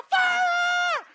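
very high, very loud shriek of "Fire"; imitated scream. Woman screaming in the background of the call. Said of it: "she could hear the girl in the back".

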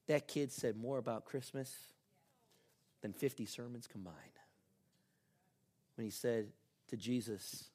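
usual speaking voice

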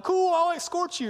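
high, loud mock-enthusiastic cop; eager cops. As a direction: call it back high and loud, playing along. Voicing imagined cops answering speeder.

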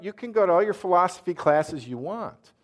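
normal talking voice

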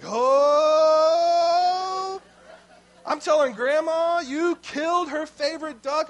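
loud, high sing-song threat from a bratty sister; taunting tattle. Retold or imitated. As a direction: gloat as you threaten to tell. Big sister tattling on brother.

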